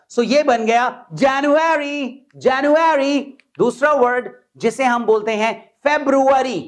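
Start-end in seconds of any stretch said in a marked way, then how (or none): 0.1-3.2 January with first syllable raised, slow; January stressed. Shown as a demonstration. Teacher demonstrates stress on January, slow and raised. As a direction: lift the start of January. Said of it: "ये ऊंचा बोला जाएगा".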